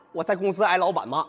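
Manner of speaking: normal talking voice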